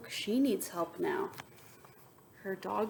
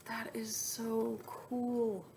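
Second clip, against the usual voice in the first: slow hushed wonder; whispering how cool it is; awed whisper; 3 dB quieter, much slower than usual. Real, not acted. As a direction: breathe it in amazement.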